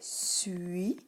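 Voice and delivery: "suis" stretched out as sss-ui; slow, drawn-out "suis"; isolated syllable. Shown as a demonstration. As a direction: sound out "suis" with a long s. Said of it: "la première syllabe est".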